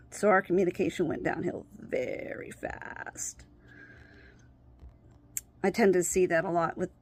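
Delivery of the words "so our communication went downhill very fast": weary whisper. Real, not acted. Speaker admitting their communication collapsed, tired whisper. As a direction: let it fall away to a whisper.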